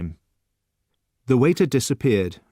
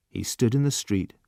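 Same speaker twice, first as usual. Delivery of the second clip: ordinary delivery, nothing marked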